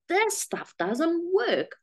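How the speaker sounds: imitating her exasperated past self; slow, frustrated giving up; fed-up despair. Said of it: "got to a point of going".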